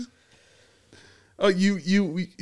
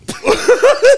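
spit take; 9 dB louder than usual. Real, not acted. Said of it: "Don't spit everywhere".

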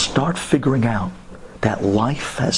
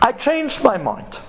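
grudging admission; 11 semitones higher than usual. Retold or imitated. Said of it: "he would never say he made a mistake".

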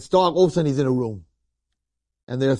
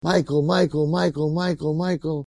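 ordinary delivery

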